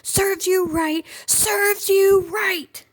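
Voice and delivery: very high, loud, slow scream. A narrator's reading. Felina screaming after the fleeing bully, high and loud. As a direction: scream it after him, triumphant. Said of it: "the little witch screamed after him".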